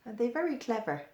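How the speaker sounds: plain reading voice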